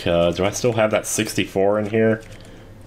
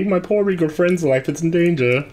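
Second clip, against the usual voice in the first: high pleading voice; 10 semitones higher than usual. Acted. NPC voice, poor stressed.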